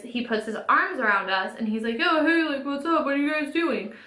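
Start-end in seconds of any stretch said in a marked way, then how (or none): 2.0-3.9 higher-pitched mock bro greeting; chummy bar guy. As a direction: imitate an over-familiar guy butting in. Imitating the guy's bar greeting.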